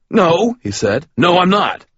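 high loud panicked no; panicked denial. A narrator's reading. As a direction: deny it in a panic. Ray protesting in fear.

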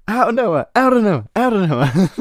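high, loud commentator yell; shrieking play-by-play catchphrase; Michael Cole. Retold or imitated. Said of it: "we don't have to hear "out of nowhere".

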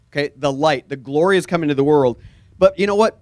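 ordinary delivery

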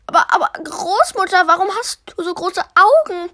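höher und schnell, verwundert; fragendes Mädchen. Acted. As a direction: frag schnell und staunend. Mädchen fragt die Großmutter. (Blaukleidchen staunt über die Augen, hohe Stimme)

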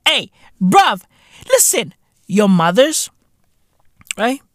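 usual speaking voice

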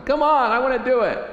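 high, loud tantrum whine; kicking and screaming. Acted. Child fighting father's correction, mock screaming tantrum. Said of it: "you're kicking and screaming".

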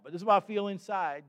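ordinary delivery

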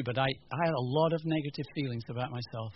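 ordinary talk

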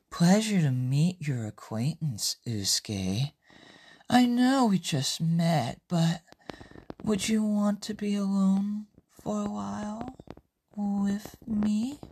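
soft, lingering seductive voice; sultry. Acted. Francesca as smitten Usuke hears her, sultry. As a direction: purr it softly, an intimate invitation. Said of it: "Francesca in a sultry voice".

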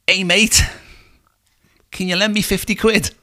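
high, loud caricature voice; cheeky begging passenger. Acted. Broke passenger asks cab driver for money, high mock pleading. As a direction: pipe up high with a hopeful plea.

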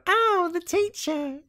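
put-on high, slow squeaky kid voice; mock child voice. Children greeting the teacher in a high squeaky voice. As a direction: imitate a little child squealing.

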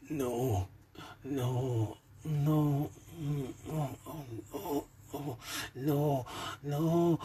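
breathy high moaned protests; woman moaning in pleasure. A narrator's reading. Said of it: "I could hear her moaning".

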